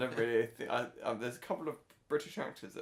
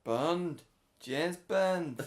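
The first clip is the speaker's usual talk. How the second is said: loud, higher, badly faked Scouse; terrible Liverpool accent; 5 semitones higher, 6 dB louder, much slower than usual. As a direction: belt out a hammed-up Liverpool accent. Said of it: "a really terrible accent".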